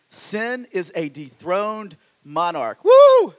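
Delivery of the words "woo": high, loud whoop of delight; real burst of praise; joyful whoop. Real, not acted. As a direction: let out a happy church whoop. Preacher whoops after a line.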